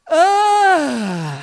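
loud, high drawn-out "ah"; long falling wail of frustration; exasperated cry. Acted. Exasperated outburst at food rules.

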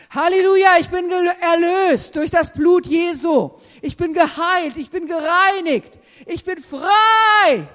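loud, high jubilant shout; exultant preacher's cry; triumphant proclamation. Real, not acted. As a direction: shout out your freedom in faith. Triumphant shouted declaration of faith.

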